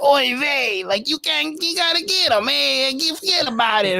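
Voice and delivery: high put-on Yiddish haggler; yiddish accent. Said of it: "He's like".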